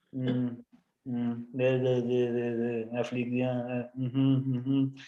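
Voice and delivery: low, soft monotone mumbling along; unimpressed God listening. Acted. God hearing Jonah's prayer, bored mumbling along. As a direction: hum and mumble through it, half-listening. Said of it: "Yo me imagino a Dios así".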